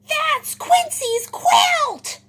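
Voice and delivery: very high, loud, slow duck squawk; stretched-out cartoon duck shout; quacking duck. A narrator's reading. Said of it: "quacks Dottie".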